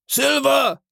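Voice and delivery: high-pitched hail; sharp rise in pitch to summon someone; calling out. A narrator's reading. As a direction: call him over, voice pitched right up. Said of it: "rief er".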